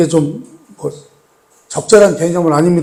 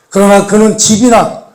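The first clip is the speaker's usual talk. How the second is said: normal talking voice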